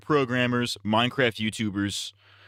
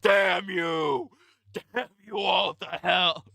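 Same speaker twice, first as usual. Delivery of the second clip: high, hammed-up shouted curse; Alex the Lion; 8 semitones higher, much slower than usual. Cartoon lion watching it all, hammed-up outraged shout. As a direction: shout it dramatically, drawing out the last words. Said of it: "Alex the Lion is watching".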